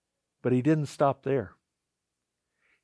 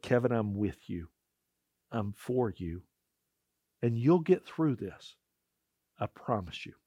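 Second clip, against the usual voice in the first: gentle reassurance; 4 semitones lower, 6 dB quieter than usual. Retold or imitated. Christ's gentle words to Kevin.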